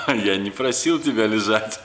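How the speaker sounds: talking through a laugh. Real, not acted. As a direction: laugh while scolding playfully. Laughing at lazy dog.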